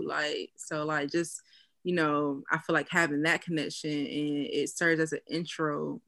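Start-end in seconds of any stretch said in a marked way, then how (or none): none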